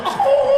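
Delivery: high loud moan; mock moan. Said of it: "She was like".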